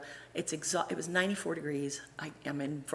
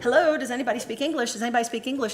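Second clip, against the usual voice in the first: high, loud plea to passengers; calling out; 7 semitones higher, 6 dB louder, faster than usual. Retold or imitated. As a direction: act out calling round the bus for help. Re-enacts calling for help on bus.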